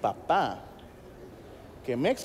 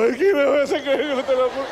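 high, loud hammed-up crying; a hammed-up wail to mom; mock sobbing; 5 semitones higher, 6 dB louder than usual. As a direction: blubber it out like a kid caught. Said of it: "Yo me suelto a llorar".

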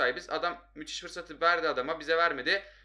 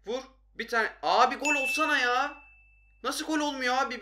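frustrated shout; 8 semitones higher, 3 dB louder than usual. Real, not acted. Player pleading at missed shots.

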